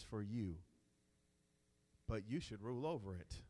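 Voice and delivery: deliberate, low-voiced Bible reading; scripture reading. Real, not acted. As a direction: read the verse out with weight. Reads scripture aloud.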